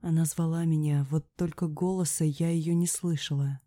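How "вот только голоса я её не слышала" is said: plain reading voice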